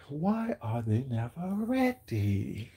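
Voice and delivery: slow, soft and offhand; quiet, unbothered, casual; nonchalant. Retold or imitated. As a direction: toss it off like it's nothing. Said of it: "she said so nonchalantly".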